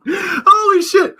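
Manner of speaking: high, loud, drawn-out "holy shit"; astonished cry. Real, not acted. Realizing the show has a drinking game and shrieking in amazement. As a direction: cry out in disbelief.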